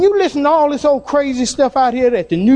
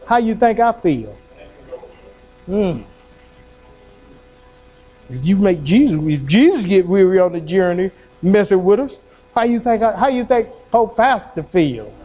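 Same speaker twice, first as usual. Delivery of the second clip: ordinary talk, nothing marked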